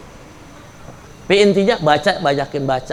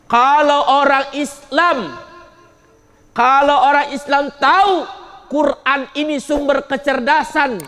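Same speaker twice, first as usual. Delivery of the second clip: raised preaching; 8 semitones higher than usual. Acted. Preacher to the congregation, raised fervent preaching. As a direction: preach it out, drawn long at first.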